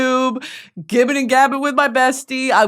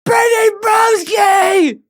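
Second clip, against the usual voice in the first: high, loud call across the river; yelled name; 10 semitones higher, 5 dB louder, slower than usual. Retold or imitated. A fan yelling across the river, high and loud. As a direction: shout her name from far off. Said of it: "Someone yells out".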